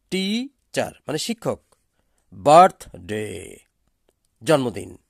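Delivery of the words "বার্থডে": Apple said loud with the weight on uh; stressed model word. Shown as a demonstration. Teacher showing pronunciation to students, loud on uh. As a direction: punch the first syllable uh in Apple. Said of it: "উচ্চারণটা দেখে দিচ্ছি".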